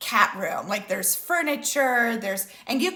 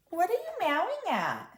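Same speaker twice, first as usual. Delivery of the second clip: higher-pitched pet-directed voice; talking to a cat; 9 semitones higher than usual. Real, not acted. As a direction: ask the cat in a high voice. Speaker turns to her meowing cat.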